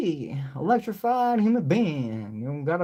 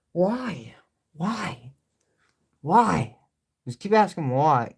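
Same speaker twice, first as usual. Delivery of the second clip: ordinary talk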